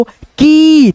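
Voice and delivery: "की" stretched very long on ee; drawn-out vowel. Shown as a demonstration. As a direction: draw the sound of "की" right out for learners. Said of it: "ध्वनि को खींचेंगे". ("की" की आवाज़ लंबी खींचकर)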